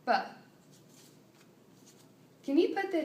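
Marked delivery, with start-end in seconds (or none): 0.1-0.1 B as one quick buh; short sound. Shown as a demonstration. Clinician showing a child the short sound, B as a quick buh. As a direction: say B as a single short burst, buh. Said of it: "The short sound".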